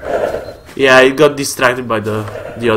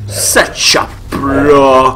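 slow, drawn-out mock praise; sarcastic; much slower than usual. Real, not acted. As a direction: stretch it out in irony. Mocks his own clumsy play.

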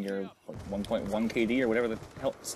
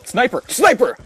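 loud, much higher callout shout; calling out; 12 semitones higher, 12 dB louder than usual. Real, not acted. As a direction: yell the warning to the team. Calling out the sniper.

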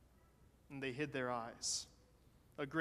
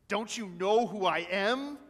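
loud, raised challenge from a storybook wolf; indignant wolf snarl; 4 semitones higher, 8 dB louder than usual. A narrator's reading. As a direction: snarl it loudly as an affronted villain. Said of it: "he snarled".

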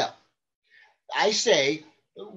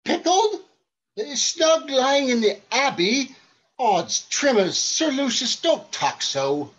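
alarmed disbelief; 3 dB louder, slower than usual. Acted. Coward alarmed by talk of death.

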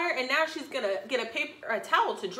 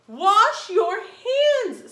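maid voice; 8 semitones higher, 10 dB louder, much slower than usual. Acted. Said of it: "said the maid".

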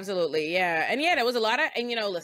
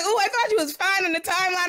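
mock surprised; 9 semitones higher, 5 dB louder than usual. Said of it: "I was like".

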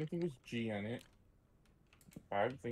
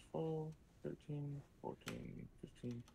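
fading murmur; 11 dB quieter than usual. Real, not acted.

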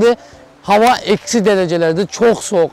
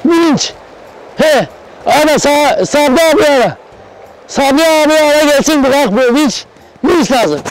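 calling out; 6 semitones higher than usual. Acted. Calling loudly for crane.

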